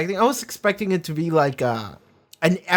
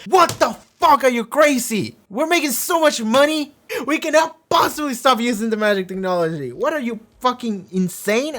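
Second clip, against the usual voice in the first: mock outrage; 7 semitones higher than usual. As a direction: ham up the greedy council's indignation. Council refuses to stop magic.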